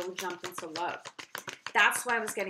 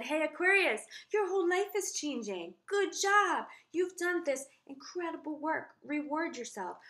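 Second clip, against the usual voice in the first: bright, higher, louder cheering to Aquarius; excited cheer; 7 semitones higher, 4 dB louder than usual. Retold or imitated. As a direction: replay your own upbeat pep talk, pitched up. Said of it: "when I said".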